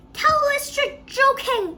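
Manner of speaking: high, loud begging; alarmed plea. A narrator's reading. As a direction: plead loudly for reassurance. Frightened grandmother pleading with Wonka.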